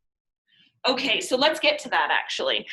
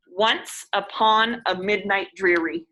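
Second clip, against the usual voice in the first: slow DUM-da scansion of "Once upon a midnight dreary"; trochaic beat; 3 dB louder, slower than usual. Shown as a demonstration. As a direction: stress "Once", "-pon", "mid-", "drear-", slowly. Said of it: "stressed, unstressed, right?".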